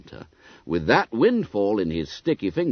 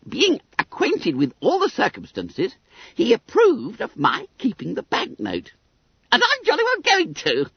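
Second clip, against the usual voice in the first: high, squeaky schoolboy voice; self-satisfied Billy Bunter; 12 semitones higher than usual. A narrator's reading. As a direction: pipe it up high, full of importance.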